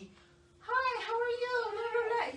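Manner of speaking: loud, high, showy English from a tipsy friend; high-pitched, loud and over the top; fake foreign language. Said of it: "De que ya literal llegas y". (inglés fingido, agudo y fuerte)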